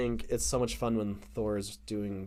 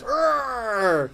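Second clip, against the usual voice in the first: imitated drawn-out high roar; Odin's yell; 10 semitones higher than usual. Host imitating an angry father cutting off his son, mock roar. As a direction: let out a long mock growling yell. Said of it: "Odin goes".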